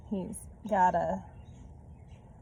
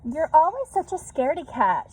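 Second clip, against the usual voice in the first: higher, louder taunt; calling out teasingly; 8 semitones higher, 6 dB louder than usual. A narrator's reading. Sheila Ray calling to Louise, loud teasing taunt. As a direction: call back to her sister, teasing. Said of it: "Sheila Ray called".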